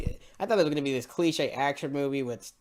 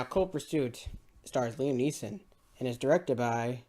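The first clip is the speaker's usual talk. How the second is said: usual speaking voice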